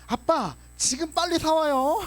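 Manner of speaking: put-on high, slow child voice ordering dad; lightly whiny kid making a demand; demanding little kid.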